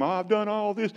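high, put-on whining voice; mock self-pitying moan; whiny self-pity. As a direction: whine it like a sulking prophet. Whiny Elijah voice.

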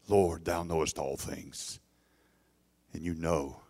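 grieved. A narrator's reading. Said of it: "being grieved".

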